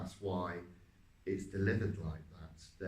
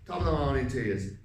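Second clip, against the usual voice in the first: loud, high Irish greeting from the throat; Irish accent; 4 semitones higher, 8 dB louder, faster than usual. Shown as a demonstration. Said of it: "to produce this sort of accent".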